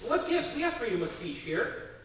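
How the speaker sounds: mock indignant protest, lightly acted; disgruntled complaint. Disgruntled Soviet citizen challenging the American visitor, indignant. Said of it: "a disgruntled Soviet citizen".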